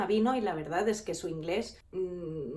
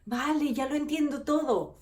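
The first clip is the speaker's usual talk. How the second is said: high sudden ah of understanding; light up as it all clicks; mock clerk finally getting it; relieved realisation; 6 semitones higher, slower than usual. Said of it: "Entonces la chica ... dijo".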